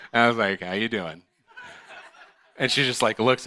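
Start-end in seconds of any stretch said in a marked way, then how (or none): 0.6-1.2 cheesy flirt. Retold or imitated. Flirty line after postcard.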